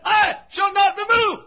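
high shouted I shall not be moved; triumphant preaching shout; shouted psalm. Retold or imitated. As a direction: proclaim it at full force. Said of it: "after four verses of singing".

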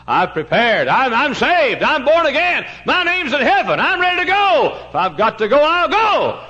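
rising, exultant pulpit shout; impassioned preaching. Real, not acted. Preacher testifies he is ready for heaven, mounting shout. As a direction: build to a high, loud declaration of faith.

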